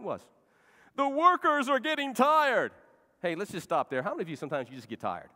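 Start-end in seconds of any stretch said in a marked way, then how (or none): 1.0-2.7 high, loud, slow moaning complaint; complaining workers. Acted. Weary workers of Judah complain, slow moaning whine.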